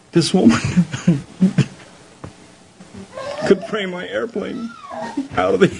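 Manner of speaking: high, broken by laughter; laughing through words. Real, not acted. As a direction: crack up while telling the punchline. Laughing through his punchline.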